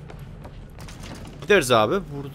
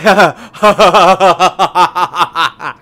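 loud laugh; 11 dB louder than usual. Real, not acted. Big laugh at game dialogue.

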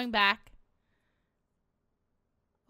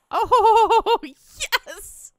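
laughing triumphantly; giddy laugh of victory; gleeful laughing; 9 semitones higher, 11 dB louder than usual. Real, not acted. Gleeful laugh at trapping player.

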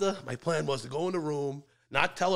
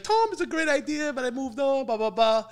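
higher voice lightly imitating his wife's broken English; a hint of the ex-wife brushing him off kindly, pitched up; 10 semitones higher than usual. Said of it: "The broken English was better".

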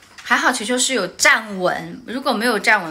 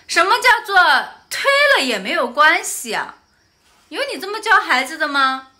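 higher, louder indignant questioning; retold angry argument; 8 semitones higher, 7 dB louder than usual. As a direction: demand how she raises her child. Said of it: "我就跟人家吵了". (愤怒地质问对方妈妈)

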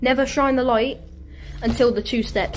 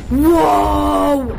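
long, loud, higher whoa; startled shout; 4 semitones higher, 7 dB louder than usual. Real, not acted. Gamer caught off guard by the game, startled shout. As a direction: cry out in shock, drawn out.

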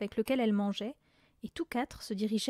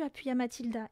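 ordinary delivery, nothing marked

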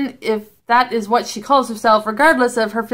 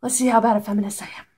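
normal talking voice

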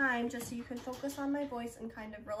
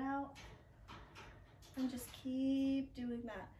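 calm relaxation guide. Acted. Guiding Savasana relaxation.

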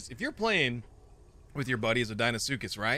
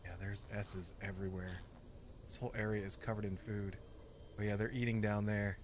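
hushed, much softer; whispering; 5 semitones lower, 9 dB quieter than usual. Real, not acted. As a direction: keep it down to a whisper. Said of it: "I'm whispering into the mic".

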